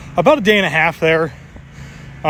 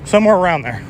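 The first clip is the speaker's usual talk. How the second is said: ordinary talk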